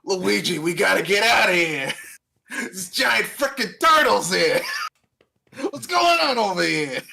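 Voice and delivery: panicked about giant turtles; shouting Mario. Retold or imitated. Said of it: "a New York Italian guy to voice".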